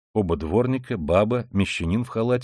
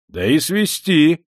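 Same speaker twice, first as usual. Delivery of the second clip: higher, louder, drawn-out insistence; emboldened; 7 semitones higher, 5 dB louder, slower than usual. A narrator's reading. Townsman urging them to march him to the office, emboldened. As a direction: chime in with new boldness. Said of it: "подхватил ободрившийся мещанин".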